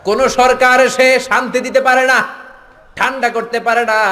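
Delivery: high-pitched sermon delivery; high, insistent preacher's cry; impassioned preaching. Real, not acted. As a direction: pitch it up to rouse the crowd.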